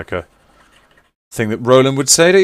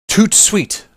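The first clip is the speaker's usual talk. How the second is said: higher-pitched put-on French; playful French flourish, pitched up; French accent; 10 semitones higher than usual. Shown as a demonstration. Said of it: "French accent".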